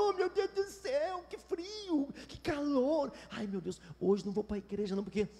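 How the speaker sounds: mock-whining, soft and high at first; whiny grumbling. Preacher mimicking believers who moan about the weather, whining. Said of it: "mas você fica choramingando".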